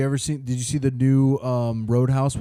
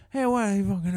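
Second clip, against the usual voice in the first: Conor McGregor; 7 semitones higher, faster than usual. Retold or imitated. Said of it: "Conor McGregor, he walks into".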